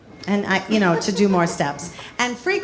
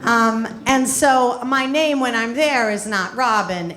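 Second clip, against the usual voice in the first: usual speaking voice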